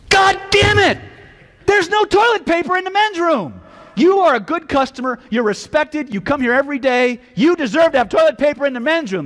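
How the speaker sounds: out-yell him on his side; hammed-up indignant yelling; matching the shout. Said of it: "his training kicked in".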